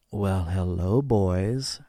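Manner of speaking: a hint of a seductive Mrs Claus; flirtatious hostess. A narrator's reading. Mrs Claus greets the visitors flirtatiously.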